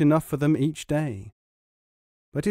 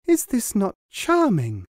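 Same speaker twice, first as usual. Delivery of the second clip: proud wife; 8 semitones higher, 4 dB louder than usual. A narrator's reading. Wife voiced.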